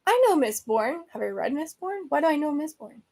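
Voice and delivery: usual speaking voice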